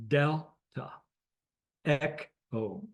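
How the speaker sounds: Delta and Echo split into two syllables; DEL, tah; phonetic alphabet demo. Shown as a demonstration. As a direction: sound out Delta, Echo with a gap inside each. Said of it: "shows you how you pronounce it".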